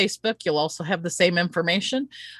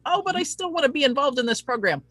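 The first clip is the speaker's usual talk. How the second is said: high, quick, lightly voiced eager viewer; a hint of a hopeful late viewer; imagined latecomer; 8 semitones higher, faster than usual. Late viewer hoping to join, voiced high and quick. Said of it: "and you're like".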